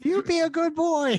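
put-on high, crooning old lady voice; old grandma voice. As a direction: coo it like a doting granny. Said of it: "I sound like the old grandma now".

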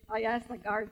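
ordinary delivery

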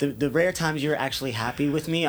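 ordinary talk, nothing marked

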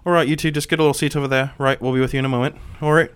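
Kadge. Acted. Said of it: "Kadge goes".

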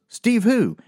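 spring the prank loudly; punchline call-out. Retold or imitated. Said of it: "Chris McCoy goes".

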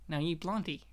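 hushed, really calm delivery; low and soft, imitating Jerry Stiller's calm. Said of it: "that really calm way".

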